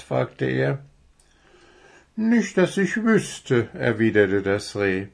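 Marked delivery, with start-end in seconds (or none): 2.2-3.7 high, slow deer answer; young deer character. Acted. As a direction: answer slowly as a little deer, pitched up.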